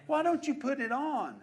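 preacher pleads, loud and high. Real, not acted. Preacher urging his congregation, loud pleading shout. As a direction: push the question out hard, pleading.

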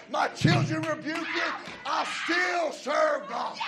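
loud, driving sermon close; preaching at full force. Acted. Pastor urging believers to carry the torch, preaching loud. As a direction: hammer it home to the church.

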